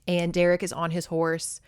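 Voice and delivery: ordinary delivery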